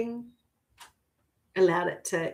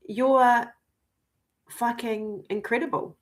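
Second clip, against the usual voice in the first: ordinary talk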